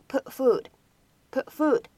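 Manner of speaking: "put food" with the t held, not released; incomplete plosion. Shown as a demonstration. Teacher showing students the unreleased t in "put food". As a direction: swallow the t of "put" before "food". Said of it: "可以有一个不完全爆破的现象".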